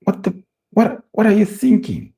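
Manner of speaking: high exasperated outburst; yelling at the tv. Real, not acted. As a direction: cry out in disbelief at the pass. Exasperated at the player's pass.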